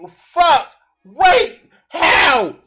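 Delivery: high, loud one-word shouts; baffled outburst. Real, not acted. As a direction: fire off each word as a separate incredulous shout.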